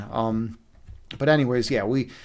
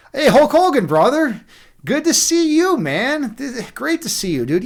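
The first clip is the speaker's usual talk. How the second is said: much higher and louder, hyped welcome; excited greeting; 10 semitones higher, 5 dB louder than usual. Real, not acted. Streamer hails a new viewer in chat, loud high excited welcome. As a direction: call out the welcome, big and high.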